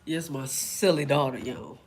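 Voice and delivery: normal talking voice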